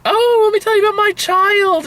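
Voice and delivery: very high, eager parent voice; gushing parent. Retold or imitated. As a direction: squeal it like an oversharer. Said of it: "it's like".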